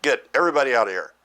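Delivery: urgent cry to the attendants; cried out. A narrator's reading. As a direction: order everyone out in a rush. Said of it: "and cried".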